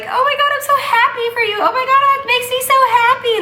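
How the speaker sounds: high, squealing rush of joy; gushing. Retold or imitated. Pregnant mom imitating how everything makes her gush, squealing. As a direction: gush like every bit of news thrills her. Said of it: "Everything just makes me like".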